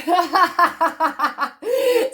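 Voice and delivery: a big high cackle of real amusement; loud high laugh. Real, not acted. Vlogger recalling the old Rufio video, laughing loudly. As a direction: crack up at the memory.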